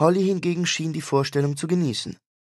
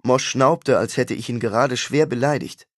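ordinary delivery

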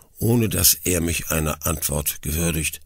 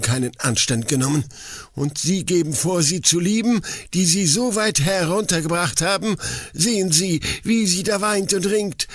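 higher, furious accusation, fast at first; grim forester; 10 semitones higher than usual. A narrator's reading. Forester furiously accuses the suitor of deceiving his daughter. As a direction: rail at the liar with scorn. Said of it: "fuhr der Forstmeister grimmig wieder an".

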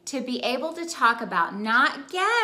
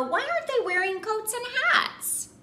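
put-on child asking; 11 semitones higher than usual. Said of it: "he asked".

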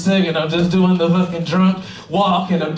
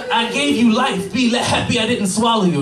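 gloating mother; 5 semitones higher than usual. Retold or imitated. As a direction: deliver the cruel punchline as a triumphant mom. Gloating mother before hanging up.

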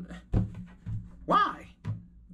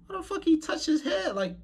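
shocked disbelief. Real, not acted. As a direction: pitch jumps way up in disbelief. Reacting in disbelief to weird cooking.

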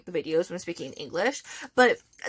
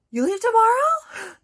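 high, loud, drawn-out disbelief; shocked; 13 semitones higher, 8 dB louder than usual. Retold or imitated. Idol learning the fan leaves tomorrow, mock shocked. Idol's shocked reaction after a gasp.

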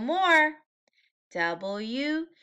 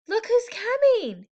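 high, loud eager call; excited; 10 semitones higher, 5 dB louder than usual. A narrator's reading. Reader to preschoolers, excited. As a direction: announce them with delight.

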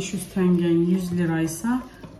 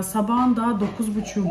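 ordinary talk, nothing marked

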